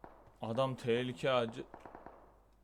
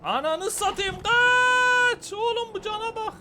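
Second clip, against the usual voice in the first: much higher panicked shrieking; being chased; 8 dB louder, slower than usual. Real, not acted. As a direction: flee screaming in panic.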